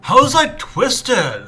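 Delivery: high, slow, puzzled voice; bewildered acted query; confused character. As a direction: drag out the baffled question. Voicing confused Lance.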